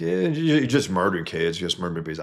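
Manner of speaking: mocking, careless throwaway quote; flippant mock accusation. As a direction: imitate someone tossing off an accusation flippantly. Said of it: "Shouldn't be flippantly called murder".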